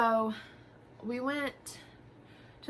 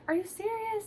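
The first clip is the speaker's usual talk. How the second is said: "serious" thrown up very high, near tears; retold mom starting to cry in disbelief; mock tearful "Are you serious?" pitched way up; 13 semitones higher than usual. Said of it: "just started crying".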